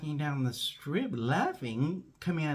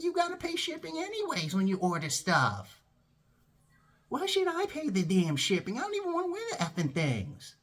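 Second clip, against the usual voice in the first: high-pitched indignant rant; exasperated; 13 semitones higher than usual. Retold or imitated. Employee refusing to pay for the sunglasses shipping, exasperated. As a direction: argue it, climbing higher to the end. Acted quarrel about shipping.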